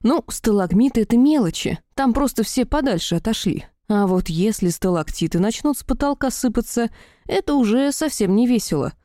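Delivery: ordinary delivery, nothing marked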